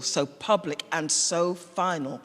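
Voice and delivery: plain reading voice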